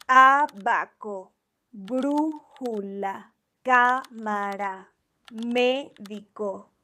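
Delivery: Abaco, brújula, cámara, médico, slow, each stressed on the first syllable; stress on third-to-last syllable. Shown as a demonstration. Teacher showing esdrújulas examples, slow first-syllable stress on Abaco, brújula, cámara, médico. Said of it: "stress is on the third to last syllable".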